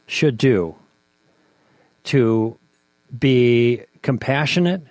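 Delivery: slow, with long pauses between phrases; emphatically spaced-out question; very deliberate question. Shown as a demonstration.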